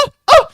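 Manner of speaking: very high, loud mock moans; mock moaning. GM imitates moans in the background, hammed up.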